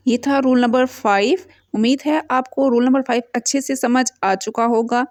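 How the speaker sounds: normal talking voice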